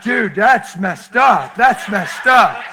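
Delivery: loud, drawn-out comic objection; mock protest. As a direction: draw each word out in loud pretend outrage. Acted protest at the joke.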